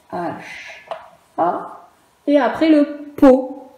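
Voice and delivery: normal talking voice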